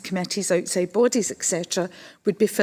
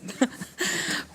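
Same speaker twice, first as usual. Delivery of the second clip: brief laugh; 5 semitones higher, 6 dB quieter than usual. Real, not acted. Amused at own loud voice.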